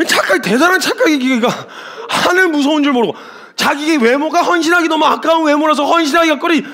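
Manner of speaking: ordinary talk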